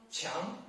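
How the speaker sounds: "qiang" sliding upward; second tone. Shown as a demonstration. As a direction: lift "qiang" like a question. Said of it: "second tone".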